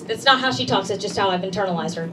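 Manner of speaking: ordinary talk, nothing marked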